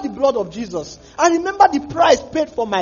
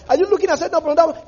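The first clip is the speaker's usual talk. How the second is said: rapid, louder nosy question; fast prying question; 5 semitones higher, 4 dB louder, much faster than usual. Pretend. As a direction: push the question quickly. Imagined questioner.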